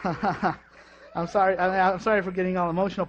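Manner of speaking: usual speaking voice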